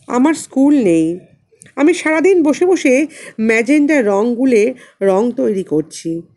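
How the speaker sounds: ordinary delivery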